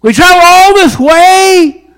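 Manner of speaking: high, loud, whining complaint; exasperated wise men. Retold or imitated. Wise men complaining at the humble house, loud whine. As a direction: wail it like a let-down traveller. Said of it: "throw up their hands in the air and go".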